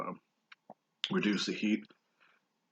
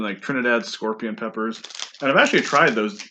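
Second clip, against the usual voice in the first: normal talking voice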